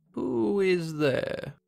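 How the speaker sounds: put-on high, very slow old woman's voice; frail grandmother. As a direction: call out thinly like a sick granny. Grandmother answers the door.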